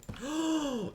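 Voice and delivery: sharp high gasp of delight; big excited intake of breath. Real, not acted. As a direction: gasp as if thrilled. Excited about update.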